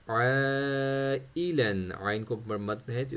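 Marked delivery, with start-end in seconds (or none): 0.1-1.9 आइलन with the ai held very long; stretched ain. Shown as a demonstration. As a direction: draw आइलन out very slowly. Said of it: "इसको हमने खींचना है".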